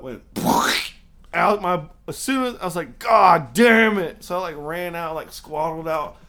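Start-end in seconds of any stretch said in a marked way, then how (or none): 3.0-4.2 loud, drawn-out slow curse; belting out a slow exasperated curse; retold curse. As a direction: drag the curse out loud and long. Retold cursing as gut gives out.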